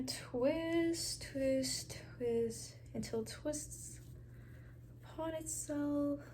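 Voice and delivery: slow whisper while working. Real, not acted. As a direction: breathe the words slowly. Whispered counting while twisting.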